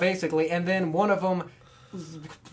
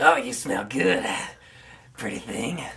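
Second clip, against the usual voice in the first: creepy hobo voice; 7 semitones lower than usual. Acted. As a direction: croon it like a cracked old drifter. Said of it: "I'll say it in the voice".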